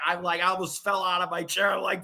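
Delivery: normal talking voice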